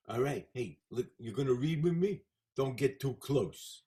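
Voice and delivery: lightly put-on tough-guy character voice; the Fonz. Actor switching voice at an audition, lightly put-on cocky character. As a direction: try a changed voice, firming up into brisk commands. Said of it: "to change my voice ... talking like this".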